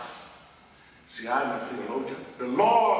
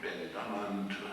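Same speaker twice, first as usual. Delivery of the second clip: low, much softer refrain; hushed refrain; 8 semitones lower, 11 dB quieter than usual. Retold or imitated. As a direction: drop right down after the big word. Muttered refrain after each pronoun.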